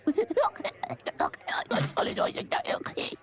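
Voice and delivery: whispered gibberish squeak; imitating squeaky voice. Stagehand imitating Toad's voice to Badger, whispered. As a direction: mimic Toad's lost voice in a breathy mumble. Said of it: "He's talking like this".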